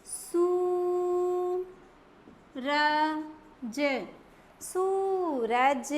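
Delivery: सूरज very slow, oo stretched; very slow teaching word; drawn-out oo. Shown as a demonstration. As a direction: draw out the long oo in सूरज. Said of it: "बड़ा sound लेंगे".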